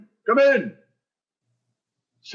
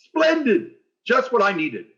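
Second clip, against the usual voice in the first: delighted; 9 semitones higher than usual. Acted. Principal delighted by gift.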